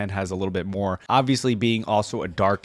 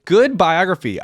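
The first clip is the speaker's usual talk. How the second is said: excited praise; 9 semitones higher, 8 dB louder than usual. Real, not acted. Excited reaction after reading.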